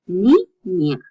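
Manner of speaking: "ni, ña" in two distinct beats; syllable by syllable. Shown as a demonstration. Teacher repeating the ñ word, "ni, ña" split up. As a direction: leave a gap between "ni" and "ña". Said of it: "you separate the two".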